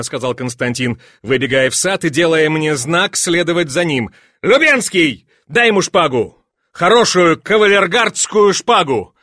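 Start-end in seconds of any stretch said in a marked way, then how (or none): 4.4-9.1 high, loud command; imperious, excited summons; calling out orders. A narrator's reading. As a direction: shout instructions to your aide. Duke calls orders to his adjutant.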